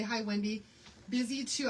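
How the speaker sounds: ordinary talk, nothing marked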